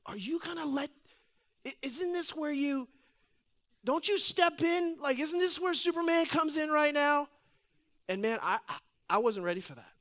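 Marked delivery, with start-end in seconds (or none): 0.1-7.3 high-pitched imitation of a pleading child; pleading son. Son looking at dad to rescue him from the needle, pleading. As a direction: voice a small boy's disbelieving plea. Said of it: "looking at me like".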